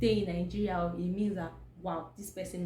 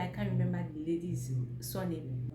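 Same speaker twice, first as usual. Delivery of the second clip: normal talking voice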